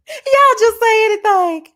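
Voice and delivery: high, loud and slow through laughter; laughing out loud at the comments. Real, not acted. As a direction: cackle at the chat.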